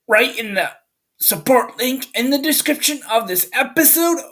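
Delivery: high, loud showman sign-off; hyped podcast outro. Acted. Host signs off Thunderhead Pictures Podcast, loud and high. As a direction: shout the show close like a radio host.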